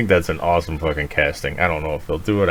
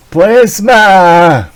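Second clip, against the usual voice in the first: high, loud hungry vampire cry; vampire shout; 12 semitones higher, 10 dB louder than usual. Acted. Mock Morbius shout.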